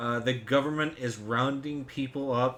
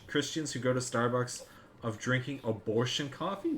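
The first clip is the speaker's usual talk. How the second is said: usual speaking voice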